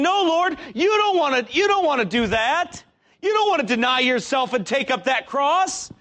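high, loud pleading tempter voice; tempter whisper. Acted. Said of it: "What does the devil whisper in your ear".

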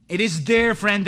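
usual speaking voice

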